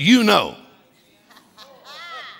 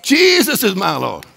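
full-force preaching; 3 semitones higher than usual. Acted. Pastor declaring deliverance to every devil, full-force preaching. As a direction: proclaim it to the whole congregation.

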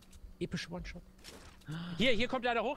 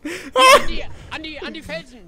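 frantic shout; 8 semitones higher, 15 dB louder, slower than usual. Real, not acted. Frantic orders in firefight.

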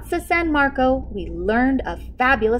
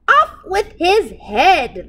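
high, loud, slow royal command; king shouting; 9 semitones higher, 7 dB louder, slower than usual. A narrator's reading. King shouts at the cook, high loud and slow. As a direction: bellow the order like a tyrant king. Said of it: "the king shouted at the cook".

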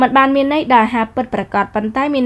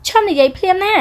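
higher, urgent stop-it; high young woman's hushed scold; 10 semitones higher, 4 dB louder than usual. Acted. Sarah cutting off the teasing man, high embarrassed scold. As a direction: cut him off, embarrassed.

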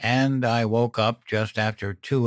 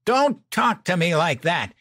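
high-pitched, raised outraged cry; screeching; 9 semitones higher, 4 dB louder than usual. A narrator's reading. Wife protests at partner's insults, high screech. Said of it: "Mom screeched".

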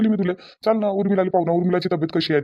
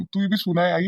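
ordinary delivery

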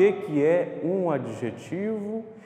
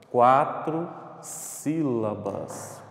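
"Quatro sílabas" drawn out very slowly; slow emphatic answer; much slower than usual. Shown as a demonstration. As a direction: stretch each word of "Quatro sílabas". Teacher stretches the answer, then repeats. ("Quatro sílabas" bem devagar, alongado)

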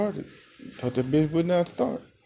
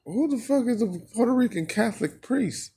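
baffled; 10 semitones higher than usual. Real, not acted. Baffled outburst.

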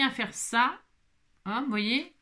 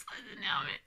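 very annoyed; 9 semitones lower, 11 dB quieter than usual. Real, not acted. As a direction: squeal it softly while cracking up.

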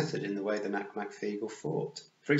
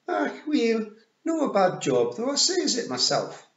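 high, loud Scots pictsie, pleased; Rob Anybody; 13 semitones higher, 7 dB louder than usual. A narrator's reading. Rob admiring the job after the fight, high Scots pictsie voice. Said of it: "said Rob Anybody".

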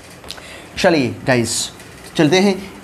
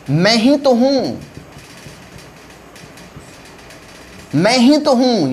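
मैं ही तो हूँ raised, ही hit hard; stress on मैं; 6 semitones higher, slower than usual. Shown as a demonstration. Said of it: "pronoun पर stress डाल रहे हैं". (मैं पर ज़ोर)

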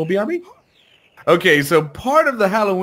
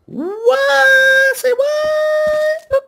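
squeaky disbelieving Say what; shocked high squeal; 4 dB louder, much slower than usual. Real, not acted. Host squeals in disbelief the headstones won't place back.

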